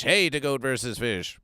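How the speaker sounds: ordinary talk, nothing marked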